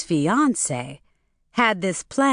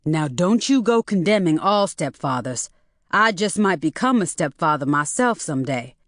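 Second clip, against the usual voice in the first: plain reading voice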